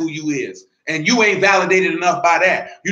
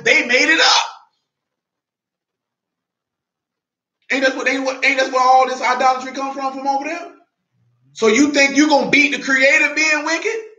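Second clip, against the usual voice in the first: rising preaching fervor; 6 semitones higher than usual. Acted. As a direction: climb from steady preaching to a shout. Preaching builds to shouts.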